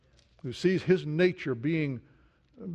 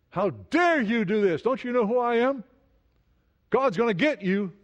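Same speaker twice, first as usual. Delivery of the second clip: raised, indignant voice of someone wronged; indignant threat; 6 semitones higher, 4 dB louder than usual. Acted. A wronged man threatening his accusers, indignant and loud. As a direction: snap back with outraged pride.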